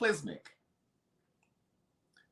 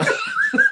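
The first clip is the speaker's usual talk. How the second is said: shrill burst of laughter, much higher; high squealing laugh; 4 dB louder than usual. Real, not acted. Cracking up at the friend's breath, a high squeal of laughter. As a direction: cackle up high and let it break.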